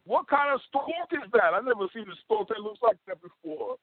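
very high-pitched cartoon rabbit. Shown as a demonstration. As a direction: squeak it out as a baffled cartoon rabbit. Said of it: "and then for the rabbit".

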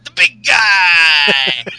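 excited mock wrestler, high and loud; Ryback. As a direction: holler like a pumped-up meathead, stretching the last words.

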